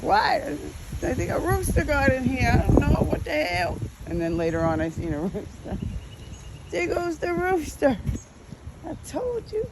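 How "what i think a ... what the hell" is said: high, innocent fake surprise; mock surprise. Pretending to kids a rooster got in, mock surprise. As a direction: play dumb about the rooster. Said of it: "I'm like".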